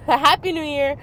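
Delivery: laughing greeting. Real, not acted. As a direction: say it again, giggling.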